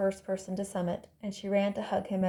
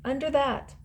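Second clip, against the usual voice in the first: calling back; 6 semitones higher than usual. A narrator's reading. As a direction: call back from among the rocks. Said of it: "his voice came from the rocks".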